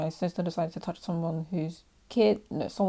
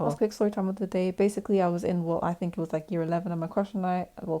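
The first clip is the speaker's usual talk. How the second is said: usual speaking voice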